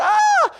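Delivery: a shrill, very high mock scream of terror. Man afraid of a spider, high terrified mock scream. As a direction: shriek way up high like someone being chased. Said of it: "Screaming, running around in the apartment".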